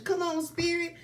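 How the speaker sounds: excited call. Real, not acted.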